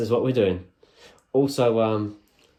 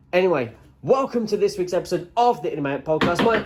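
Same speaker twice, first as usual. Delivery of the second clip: podcast announcer; 8 semitones higher, 4 dB louder than usual. Acted. Third intro attempt, announcer voice.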